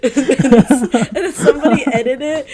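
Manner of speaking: high, loud, real laughter breaking the words; laughing hard. Real, not acted. Fan retelling a favourite clip to friends, helpless laughter. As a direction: tell it while cracking up.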